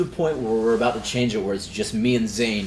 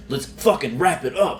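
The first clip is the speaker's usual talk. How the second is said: mock stage yell; 6 semitones higher, 4 dB louder than usual. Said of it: "under his breath screaming at us".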